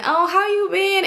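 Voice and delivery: high-pitched, put-on friendly hello; mock gushing greeting. False friend greeting her at the club, mock gushing. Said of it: "you wanna scream and".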